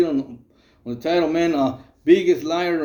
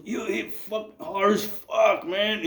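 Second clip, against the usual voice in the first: mock pained groan; 3 semitones higher, 5 dB quieter, slower than usual. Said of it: "he goes".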